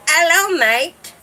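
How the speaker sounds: light mock-Australian lilt; Australian accent. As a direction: mimic an Australian, lifting the end. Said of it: "the guys from Australia".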